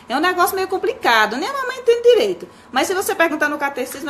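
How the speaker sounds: lightly acted exasperation, voice climbing high; flustered parent explaining. Parent flustered explaining Trinity to child. (mãe atrapalhada explicando, tom agudo)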